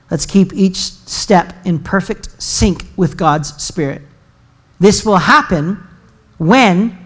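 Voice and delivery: scripture reading. Acted. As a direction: read it out deliberately with long pauses between phrases. Reads scripture aloud to congregation.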